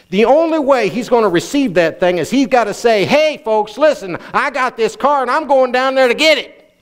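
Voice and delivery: preacher proclaiming it to the folks; fervent preaching. Acted. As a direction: build to a bold public announcement. Preaches at heightened pitch, acting out declaration.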